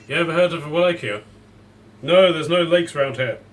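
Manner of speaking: loud call down from the sky; shouting from above. Retold or imitated. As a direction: shout it from a flying Pegasus. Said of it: "he just shouts out to you".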